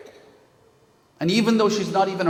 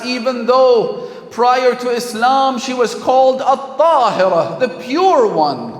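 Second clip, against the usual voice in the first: full-force preaching; 7 semitones higher, 6 dB louder than usual. Acted. Preacher lamenting to the congregation, with impassioned force. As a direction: build to a ringing climax from the pulpit.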